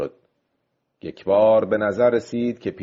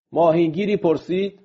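ordinary delivery, nothing marked

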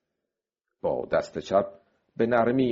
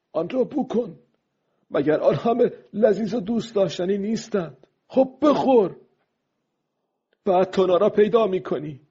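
very high, urgent coaxing call; excited fisherman urging the fish on; old man calling aloud; 12 semitones higher, 4 dB louder than usual. A narrator's reading. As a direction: call the fish in, pitched right up. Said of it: "پیرمرد با صدای بلند گفت".

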